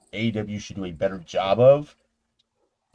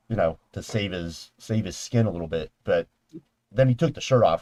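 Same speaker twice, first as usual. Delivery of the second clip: ordinary talk, nothing marked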